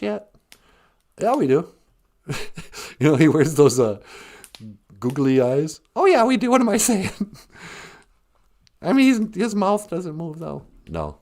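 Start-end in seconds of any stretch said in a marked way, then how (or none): 1.2-5.8 much lower, fast, different voice; a low companion voice chiming in; puppet partner voice. Acted. Puppet partner complaining about Minneapolis drivers, low voice. 9.1-11.2 much lower, fast, different voice; a low companion voice chiming in; puppet partner voice. Acted. Puppet partner complaining about Minneapolis drivers, low voice.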